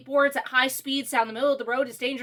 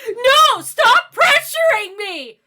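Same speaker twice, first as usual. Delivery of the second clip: loud, high imitation of a bratty teen yelling; exaggerated shout, drawn out at the end; mock angry outburst; 9 semitones higher, 10 dB louder, much slower than usual. Mimicking a daughter to her calm dad, mock angry shout.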